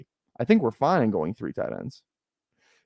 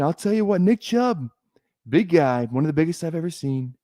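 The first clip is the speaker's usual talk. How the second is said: higher-pitched boastful politician impersonation; Donald Trump; 5 semitones higher than usual. Acted. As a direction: do a playful presidential impersonation, pitched up. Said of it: "I like doing the Trump accent".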